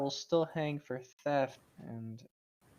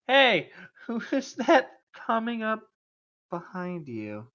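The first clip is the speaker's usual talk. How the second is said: mock alarm; 7 semitones higher, 6 dB louder, slower than usual. Iggy's distraction trick.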